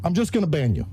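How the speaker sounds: high, emphatic threat; angry warning. Real, not acted. Streamer threatening chat with bans, angry warning.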